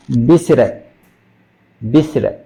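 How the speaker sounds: visited with the T as a quick tap, "visiret"; flap T. Shown as a demonstration. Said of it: "Recuerde ese flap, la flap T".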